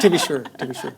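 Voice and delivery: a hammed-up Irish lilt, laughing through it; mock Irish accent. Joking to the Irish hosts in a playful Irish accent. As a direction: put on a jokey Irish brogue. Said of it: "I know that's not the proper Irish accent".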